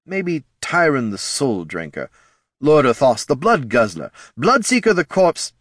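high, relishing pitch of grand names; head of marketing, brainstorming. Acted.

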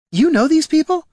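high, loud disbelieving question; shocked. Acted. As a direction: ask it in bewildered shock. Jelana shocked at her ally.

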